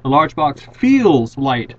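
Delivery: "feels" drawn out slowly; slow stress on feels. Shown as a demonstration. Teacher to class, slowly leaning on the present verb. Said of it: "This is the word that's changing".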